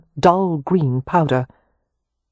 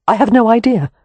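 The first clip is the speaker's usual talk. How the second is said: distressed; 7 semitones higher, 6 dB louder than usual. A narrator's reading. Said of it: "looking more distressed".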